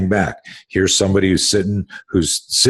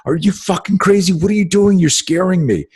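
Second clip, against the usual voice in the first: very high, freaked-out protest; high-pitched, incredulous alarm; alarmed daughter; 11 semitones higher than usual. Retold or imitated. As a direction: imitate a frightened daughter telling dad off. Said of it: "She's confused".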